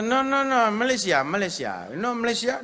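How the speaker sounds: flustered pakcik. Retold or imitated. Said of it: "Pakcik kata".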